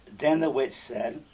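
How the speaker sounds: ordinary delivery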